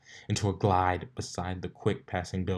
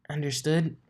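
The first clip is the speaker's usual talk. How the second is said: Rarity voice; 7 semitones higher than usual. Acted. Rarity's reply, odd timbre.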